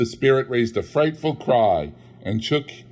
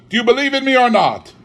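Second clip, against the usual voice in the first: high, loud and fast ghost demand; wailing spectral demand; 14 semitones higher, 8 dB louder, faster than usual. Acted. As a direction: hurl it like a restless spirit.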